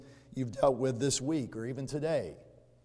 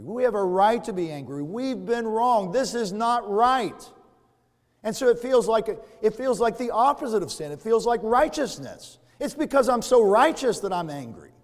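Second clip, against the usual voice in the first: rising, loud self-justifying rant; mock righteous indignation; self-righteous anger; 5 semitones higher, 5 dB louder than usual. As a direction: build to an indignant peak.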